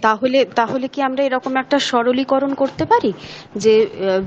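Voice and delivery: normal talking voice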